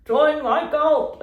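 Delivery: slow, high, loud cult-leader call; shouting a cult invite into the forest; mock cult shout. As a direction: bellow it after someone walking away. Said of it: "I'd be shouting".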